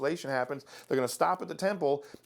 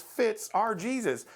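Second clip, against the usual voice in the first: ordinary delivery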